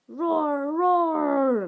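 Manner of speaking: loud mock dinosaur roar; growling roar. Boy playing a beast while drawing, growling roar. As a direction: roar like a pretend rhinoceros. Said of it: "growled Little Bill".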